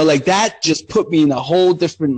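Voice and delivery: normal talking voice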